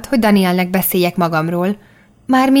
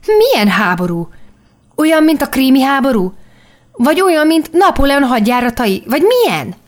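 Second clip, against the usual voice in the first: demanding; 6 semitones higher than usual. A narrator's reading. Daniel demands to know which war, pressing her. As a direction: insist on an answer. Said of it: "kérdezte követelőzően".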